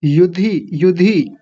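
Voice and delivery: युधि with short u and short i; short vowels. Shown as a demonstration. Teacher drills the word, युधि with two short vowels. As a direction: keep both syllables of युधि brief. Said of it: "दोनों छोटी मात्राएं हैं".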